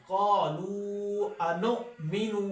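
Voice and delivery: slow melodic tajweed recitation; Quran recitation. Shown as a demonstration. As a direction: recite slowly and carefully.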